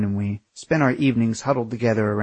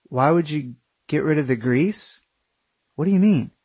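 confused; 4 semitones higher than usual. Acted. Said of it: "Corey was confused".

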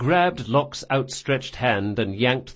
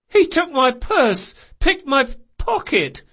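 high, slow plaintive lament; tearful boy; 14 semitones higher, 5 dB louder than usual. Acted. Robbed boy to old woman, high tearful complaint. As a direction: wail it as a robbed child.

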